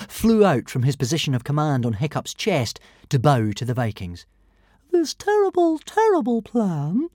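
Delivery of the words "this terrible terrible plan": high, slow, gleeful little dragon voice; joyful tiny dragon. A narrator's reading. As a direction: crow it out like a tiny emperor. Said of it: "announced Zigarastica joyfully".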